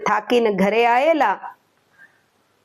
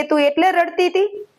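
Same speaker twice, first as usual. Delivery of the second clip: higher, disbelieving question; you cried over that?; lightly amused disbelief; incredulous; 7 semitones higher than usual. Acted. Husband hears why she cried.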